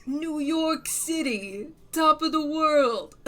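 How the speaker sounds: loud, drawn-out, high imitation; quoted actress's line. Streamer quoting a favourite actress to viewers, grand loud imitation. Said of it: "There's this way she says".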